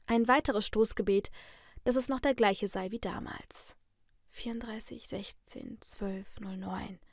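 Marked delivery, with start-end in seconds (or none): 4.3-7.0 very soft, half-whispered digits; murmuring to oneself. A narrator's reading. Woman recalling the safe code, murmuring to herself. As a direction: count it out under your breath. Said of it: "murmelte ich vor mir her".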